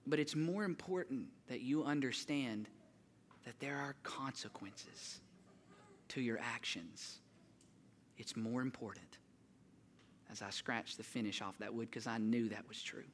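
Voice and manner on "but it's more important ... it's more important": serious dad. Retold or imitated. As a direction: hush down into a stern fatherly lesson. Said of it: "he got really serious".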